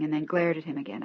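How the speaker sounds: ordinary delivery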